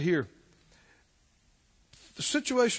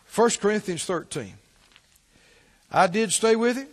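ordinary talk

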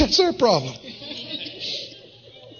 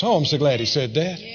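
normal talking voice

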